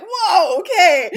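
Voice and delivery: loud, high startled reaction with a laugh; jolted by a sudden noise, playing it up. Mom reacting to baby's sudden screams, mock startled.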